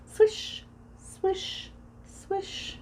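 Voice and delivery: high, airy, spaced-out swishes; lazy high-pitched water sounds; slow swishes. A narrator's reading. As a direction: leave a pause after each swish. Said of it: "went in slow circles".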